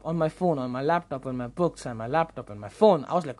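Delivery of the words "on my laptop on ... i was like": ordinary talk, nothing marked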